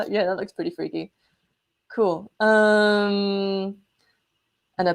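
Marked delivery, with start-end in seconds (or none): none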